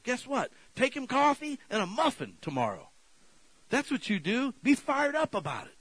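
high, loud, fired-up preaching; full-force preaching. Real, not acted. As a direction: preach it hard and lift the room.

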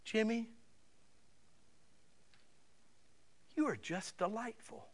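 high, soft Southern lady voice; gentle Southern accent, pitched high. Acted. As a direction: play a sweet older Southern lady. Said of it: "In her southern accent, she looked and she goes".

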